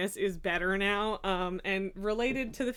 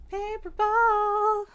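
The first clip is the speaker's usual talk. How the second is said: much higher, louder drawn-out call of Paper ball; high slow sing-song; 11 semitones higher, 7 dB louder, much slower than usual. Real, not acted. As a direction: stretch Paper ball up high to lure the cat. Luring cat twice.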